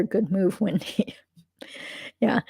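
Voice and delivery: usual speaking voice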